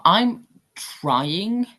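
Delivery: normal talking voice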